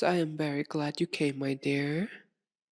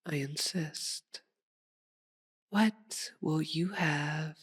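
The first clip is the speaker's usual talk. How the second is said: ordinary delivery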